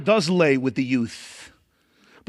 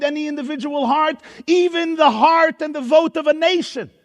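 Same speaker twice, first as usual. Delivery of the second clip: rising, higher-pitched preacher's build; preaching; 7 semitones higher than usual. Acted. Rabbi exhorting his audience, preaching with rising force. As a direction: preach it with climbing intensity, listing each item.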